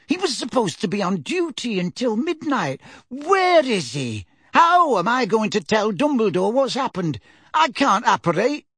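put-on flustered elderly neighbour; fretful old lady. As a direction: panic about telling the headmaster. Mrs Figg panicking.

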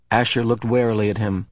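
ordinary delivery

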